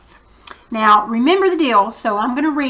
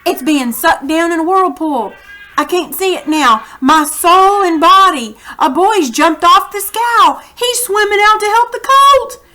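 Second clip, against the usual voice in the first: short anxious bursts that rise higher; nervous report; 5 semitones higher, 6 dB louder than usual. Acted. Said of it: "reporting in short, nervous sentences".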